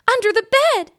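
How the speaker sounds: very high, loud panicked cry; frightened mother screams out; alarmed shriek. A narrator's reading.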